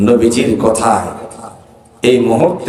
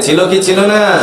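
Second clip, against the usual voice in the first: high, raised repeat question; insistent prompting; 7 semitones higher than usual. Real, not acted. Preacher chiding crowd for not answering, high insistent question. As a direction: press "ছিল কি ছিল না" on a silent crowd. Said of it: "কথা কন না কে".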